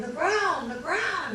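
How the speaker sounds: high panicked cry; terrified warning about the ground; screamed. Retold or imitated. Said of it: "I screamed".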